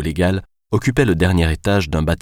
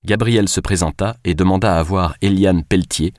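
usual speaking voice

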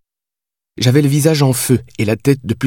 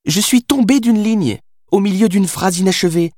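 insistent Billy; 10 semitones higher than usual. A narrator's reading. Billy insists she fell out of his book, emphatic. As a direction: spell it out in a raised character voice.

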